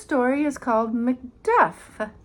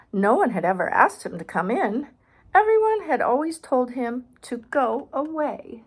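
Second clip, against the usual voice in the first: put-on reading aloud to a child, clear and bright; storybook reading voice; story narration; 6 dB louder than usual. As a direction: narrate the picture book. Reads picture book aloud.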